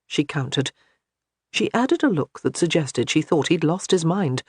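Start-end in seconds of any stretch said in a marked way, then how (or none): none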